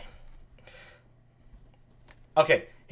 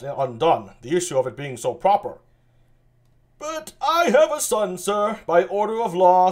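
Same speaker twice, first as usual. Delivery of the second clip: voiced old-court characters, raised pitch; Shakespeare reading; 4 semitones higher, 9 dB louder than usual. Reading Lear aloud alone, lightly voiced noblemen. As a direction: read the lords' lines with a lifted stage voice.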